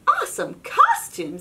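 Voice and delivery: high, loud thrilled exclaim; wowed by the costume; very high excited praise; delighted Pete. Acted. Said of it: "says Pete".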